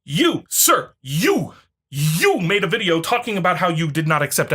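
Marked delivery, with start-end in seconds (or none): none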